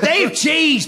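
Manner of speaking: scolding shout. Real, not acted. Scolding the blue joke.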